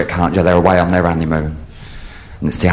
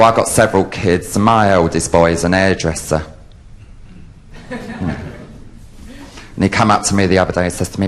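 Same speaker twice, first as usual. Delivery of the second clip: ordinary talk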